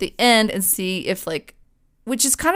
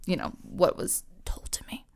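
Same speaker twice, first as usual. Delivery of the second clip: voiceless whisper trailing off; whispered aside; 2 semitones lower, 12 dB quieter than usual. Real, not acted. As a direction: let it fall to a whisper.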